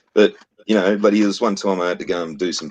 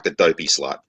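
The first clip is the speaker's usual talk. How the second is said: ordinary talk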